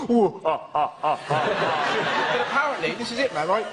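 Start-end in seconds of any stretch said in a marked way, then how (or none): 0.1-2.3 slow, drawn-out nervous ooh and ahs; mock nervous noises. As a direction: ham up a jittery suspect. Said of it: "walking along with a moustache going".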